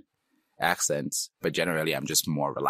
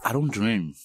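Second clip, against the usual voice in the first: ordinary talk, nothing marked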